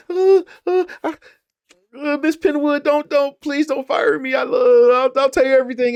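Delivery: very high, loud, stammering plea; mock panicked begging. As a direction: squeal it like a terrified maid begging not to be fired. Maid begging Penwood, mocked.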